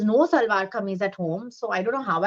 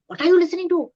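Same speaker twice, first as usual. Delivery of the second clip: imitating a mother, higher and louder; quick, raised-pitch question from a mum walking in; surprised mother; 10 semitones higher, 6 dB louder, much faster than usual. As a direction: act out a startled mother's demand. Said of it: "my mother came in at that time unexpectedly early".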